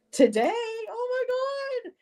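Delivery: high, delighted shock; surprised. Retold or imitated. Retelling seeing the early delivery, high surprised squeal. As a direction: gasp out the surprise. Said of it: "I went".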